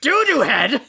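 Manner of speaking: high indignant villain squeak; Skeletor voice. Acted. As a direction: squeak it back in disbelief. Skeletor outraged at insult.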